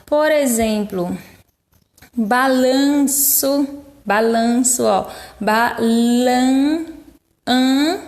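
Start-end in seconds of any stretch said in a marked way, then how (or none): 2.2-3.8 "balanço" drawn out very slowly with the nasal "an" held; slow, stretched demo. Shown as a demonstration. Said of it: "é um som nasal". 5.4-8.0 "Balan-" then "an" alone, sounded through the nose; nasal syllable shown. Shown as a demonstration. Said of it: "é um som nasal".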